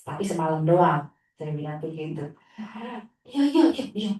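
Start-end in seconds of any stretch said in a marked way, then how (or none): 3.2-4.1 put-on higher, louder kid's quick yeses; eager child. Teacher imitating the boy agreeing eagerly, high childish chirps. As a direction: chirp yes yes like a child promising.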